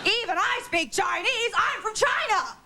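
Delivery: high, slow, proud boast; hammed-up lady announcing she's from China; exaggerated Jane Meadows. Imitating Jane Meadows' claim.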